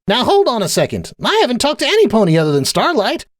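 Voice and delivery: high, indignant character voice; Sunburst, indignant. A narrator's reading. Sunburst defending himself to Applejack, high and indignant. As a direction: protest innocence in a high voice.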